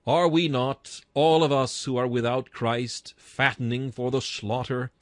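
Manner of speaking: normal talking voice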